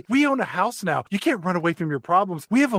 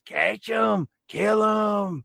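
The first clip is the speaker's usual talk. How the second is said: ghost voice; 6 semitones higher, much slower than usual. Retold or imitated. House voice, far, shout.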